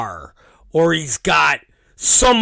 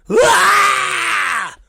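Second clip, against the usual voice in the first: put-on kid's crying yell; 13 semitones higher than usual. Hurt boy crying out from the other room, high mock wail. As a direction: wail like a hit toddler.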